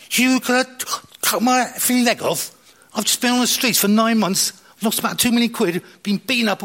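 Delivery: high, loud, indignant rant at the doctors; angry outburst. Retold or imitated. Telling the doctors off about losing his leg, in a furious high rant. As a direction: let it rip, outraged. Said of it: "Didn't say it so aggressively".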